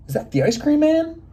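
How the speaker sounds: high, excited moose question; eager question. Acted. Moose hears the bell and asks eagerly, excited. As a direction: perk up at the bell, hopeful.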